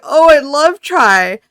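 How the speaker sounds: high, loud, drawn-out imitation of delight; delighted mock exclamation. Fan quoting her bias about her favorite song, excitedly. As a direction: imitate an idol gushing, slowly and loudly.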